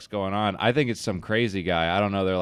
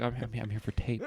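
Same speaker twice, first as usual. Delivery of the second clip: soft, faster, trembling little voice; quiet and quivery; 2 semitones higher, 9 dB quieter, faster than usual. Retold or imitated. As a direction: mumble it nervously, barely there. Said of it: "I said it all quiet and quivery".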